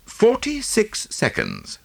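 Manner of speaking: slow and high. Acted. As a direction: spell out the time slowly. Hastings replies, slow and high.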